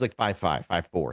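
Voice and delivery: usual speaking voice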